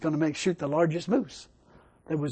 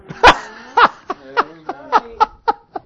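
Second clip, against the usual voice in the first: big high-pitched burst of laughter; 8 dB louder than usual. Real, not acted. Preacher laughs after telling the moose story, loud. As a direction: laugh hard at the story's end.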